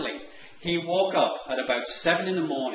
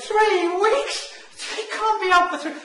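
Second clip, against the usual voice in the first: much higher, louder panicked cry; panicked squeal; 5 dB louder than usual. Retold or imitated. Groom panics at three weeks.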